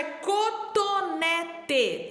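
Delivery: cotonete syllable by syllable; sounded out slowly. Shown as a demonstration. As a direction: stretch each syllable so children can spell it. Teacher sounds out word slowly. (cotonete sílaba por sílaba, devagar)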